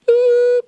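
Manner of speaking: high sung beep tone; beep sound. Pretend. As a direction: chirp a short machine beep. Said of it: "Please answer after the tone".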